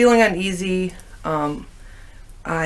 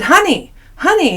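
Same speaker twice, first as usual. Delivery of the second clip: loud, high call to her husband; 9 semitones higher, 6 dB louder than usual. Retold or imitated. Calling her husband across a stadium, loud and high. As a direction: call him urgently across the room.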